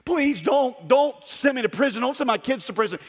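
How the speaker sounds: high, pleading beggar on his knees; pleading debtor. Retold or imitated. Debtor on his knees begging the lender, high pleading. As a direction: beg for mercy, voice up high. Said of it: "he begins to weep".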